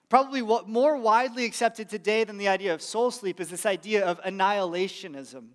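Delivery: ordinary delivery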